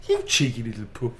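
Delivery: broad Birmingham tease; playful Brummie after a laugh; cheeky Brummie. Shown as a demonstration. Finishing Kim's cheeky message in Brummie. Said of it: "this is a cheeky, funny little message".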